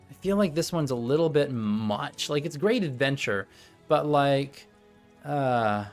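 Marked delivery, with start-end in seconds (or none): none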